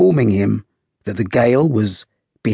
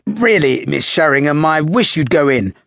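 anxious exclamation, a bit louder; worried officer's firm exclaim; 6 semitones higher, 4 dB louder than usual. A narrator's reading. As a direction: press her urgently to go in. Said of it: "exclaimed the third officer".

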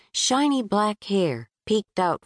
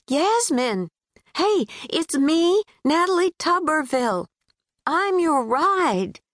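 a lightly voiced friendly Southern girl calling out; 10 semitones higher than usual. Natalie voiced by narrator.

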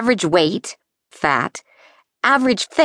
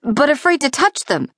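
plain reading voice

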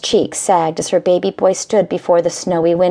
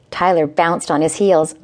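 ordinary delivery, nothing marked

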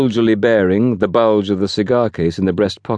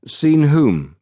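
higher-pitched, mistrustful question; 8 semitones higher than usual. A narrator's reading. Fenn questions the station master, mistrustful. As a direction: ask it with guarded suspicion. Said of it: "Fenn demanded mistrustfully".

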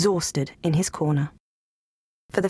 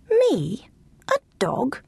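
disbelieving echo; 6 semitones higher, slower than usual. Acted. Lulu Bell echoes in disbelief.

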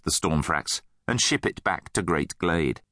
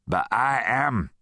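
slow, cool defiance; cool retort; 3 semitones higher, slower than usual. A narrator's reading. Rudd steps in to defend Nate, coolly defiant. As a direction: square up to him coolly. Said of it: "said Rudd coolly".

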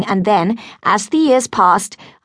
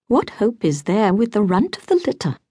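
ordinary delivery

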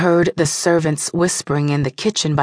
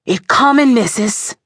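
urgent call; 5 semitones higher than usual. Acted. Midwife to the mother as the baby emerges, urgent call. Midwife calls out during birth.

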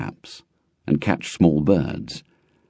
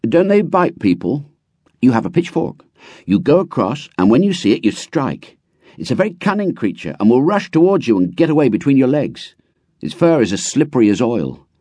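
put-on higher boy's voice; a boy keen to share; eager boy; 6 semitones higher than usual. A narrator's reading. Runtu inviting his friend, higher eager boy.

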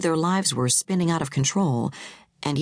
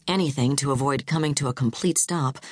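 plain reading voice

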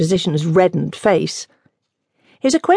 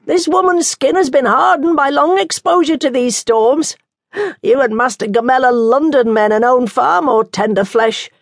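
nun voice; 6 semitones higher, 3 dB louder than usual. Acted.

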